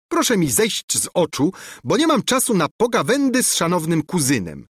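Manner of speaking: a princess ordering her cousin away; haughty princess. A narrator's reading.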